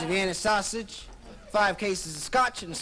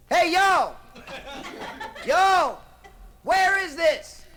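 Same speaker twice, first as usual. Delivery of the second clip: much higher, much louder calling out; hailing shout; 10 semitones higher, 7 dB louder, much slower than usual. Acted. Chris calling from the rail to the shore, loud. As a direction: holler across to the beach. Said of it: "He says".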